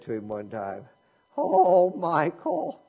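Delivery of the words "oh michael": a mild, slightly higher gushing "Oh, Michael"; lightly voiced admiring college lady; imitating the lady. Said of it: "that's the way she talked".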